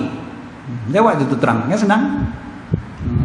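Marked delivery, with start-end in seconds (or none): none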